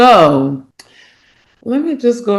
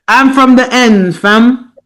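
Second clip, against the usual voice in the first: louder, higher mock London slang; hammed-up London roadman voice; mock London roadman accent; 6 semitones higher, 7 dB louder, slower than usual. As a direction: imitate a London drill rapper. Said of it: "for rapping".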